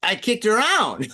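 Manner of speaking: high, drawn-out indignant exclamation; emphatic protest. Real, not acted. Man telling friend what he did to ex, emphatic. Defends himself to needling friend.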